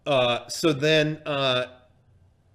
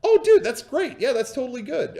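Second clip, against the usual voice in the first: high, loud burst of approval, then easing; acted-out eager delight; enthusiastic; 9 semitones higher, 4 dB louder than usual. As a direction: gush like a fellow gamer. Reenacts his sudden enthusiasm.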